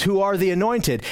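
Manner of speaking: normal talking voice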